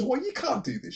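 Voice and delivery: high mock woman's protest. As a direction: squeak it out like the journalist. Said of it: "And she goes".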